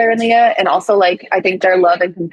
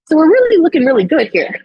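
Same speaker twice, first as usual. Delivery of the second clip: eager sales pitch; 9 semitones higher than usual. Retold or imitated. Pitching plan to parents.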